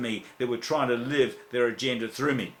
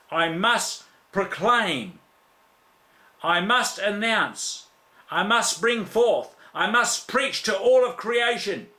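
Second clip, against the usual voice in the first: slow, higher, rising fervour; fervent preaching; 5 semitones higher, slower than usual. Real, not acted. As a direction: build each must like a pulpit cry. Preaching at full force.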